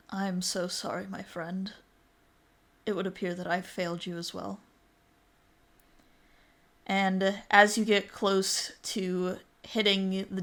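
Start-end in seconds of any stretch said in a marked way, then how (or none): 0.1-4.6 put-on soft, sorry old friend voice; saddened apology. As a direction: apologize softly, letting the friend down. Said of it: "gives you a saddened look".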